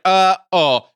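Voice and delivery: loud, high mock alarm; caught-out uh-oh. Beefcake realizing he walked in too early, mock alarm. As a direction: blurt a drawn-out uh-oh. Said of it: "for a second, he's like".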